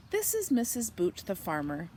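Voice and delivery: ordinary delivery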